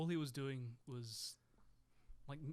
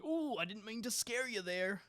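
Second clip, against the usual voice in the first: high, sly mock villain voice; mustache twirling villain; 7 semitones higher, 5 dB louder than usual. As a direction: play a hammy cartoon baddie, smug and teasing. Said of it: "like, mustache twirling villain".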